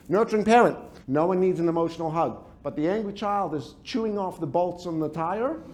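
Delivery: ordinary delivery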